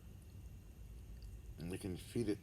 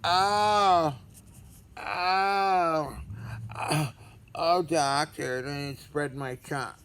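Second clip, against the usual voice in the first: high, loud hammed-up moans at the doctor; mock moaning patient; 9 semitones higher, 11 dB louder, much slower than usual. Pretending a patient moans to his doctor, hammed-up. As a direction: overplay a moaning patient, drawn out. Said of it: "it's just me moaning".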